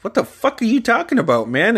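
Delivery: high, disbelieving reenacted outburst; incredulous protest. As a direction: retell it as a startled, indignant challenge. Acting out his startled objection.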